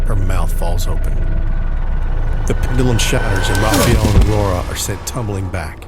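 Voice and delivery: rising to a dramatic loud peak; building loud narration. A narrator's reading. Climax of the pendulum scene.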